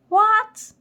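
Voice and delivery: a sharp, high, loud shout of disbelief; shouted what. A narrator's reading. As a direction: bark it up high like an outraged queen. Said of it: "shouted Qu-, Quintot".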